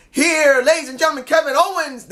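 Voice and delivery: high-pitched mock ring introduction; ring announcer. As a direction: call out a wrestler's entrance.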